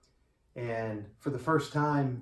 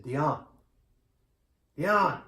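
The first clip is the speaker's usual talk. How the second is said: wife calling her sleeping husband, louder on the second call; calling his name; 3 semitones higher, 4 dB louder than usual. Retold or imitated.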